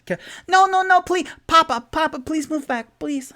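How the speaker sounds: high, loud, frantic begging; desperate pleading. Acted. Halfling girl begs her rat father to move back, frantic. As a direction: plead with Papa to back away. Creature daughter begs Papa.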